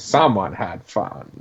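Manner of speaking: slow, sly aside; knowing wink. Retold or imitated. Villain winking at the camera, slow knowing delivery. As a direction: draw it out like an in-joke. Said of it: "that's a little wink, wink, nod, nod".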